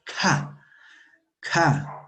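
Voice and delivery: "K" as "kha", air rushing from the mouth; aspirated K. Shown as a demonstration. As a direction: puff air through the "K". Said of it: "sale aire de nuestra boca".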